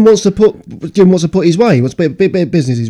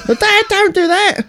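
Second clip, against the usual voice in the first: put-on high, pleading child voice; childlike plea; 14 semitones higher, 4 dB quieter, slower than usual. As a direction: plead like a little kid. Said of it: "retelling dad scene".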